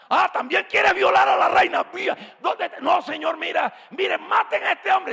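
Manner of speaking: high, loud enraged orders; shouted royal fury; furious king. Acted. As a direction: rage at the traitor.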